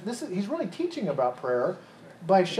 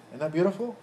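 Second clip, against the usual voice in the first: ordinary delivery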